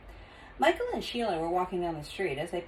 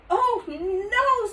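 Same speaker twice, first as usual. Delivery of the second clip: alarmed; 4 dB louder than usual. Acted. Michael alarmed.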